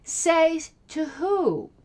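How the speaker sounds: "Say to who?" stretched out very slowly, daring an answer; slow scornful challenge. Real, not acted. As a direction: drag the question out, unimpressed. Scoffing at opponent's claim.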